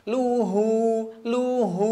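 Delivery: luhu with no humming in the nose; clean luhu. Shown as a demonstration. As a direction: drill luhu, nothing nasal. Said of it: "diperiksa terus hidungnya". (luhu tanpa dengung)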